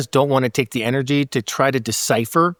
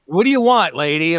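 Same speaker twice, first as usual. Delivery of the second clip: impatient waiter; 4 semitones higher than usual. Retold or imitated. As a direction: bark the order question at a customer.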